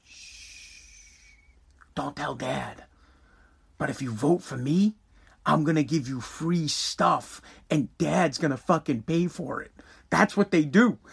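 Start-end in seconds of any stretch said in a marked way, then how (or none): 0.0-9.7 much softer hushed mom voice; secretive mom. Retold or imitated. Mom bribing her kid for a vote behind dad's back, hushed. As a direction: whisper the bribe like a secret. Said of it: "Mom comes in".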